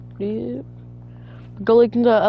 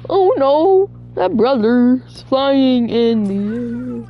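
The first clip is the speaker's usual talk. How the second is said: higher, louder and slowed as it happens; excited alarm; 4 semitones higher, 4 dB louder, much slower than usual. Real, not acted. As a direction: gasp and drag out the words watching it fly. Zombie launched into the air.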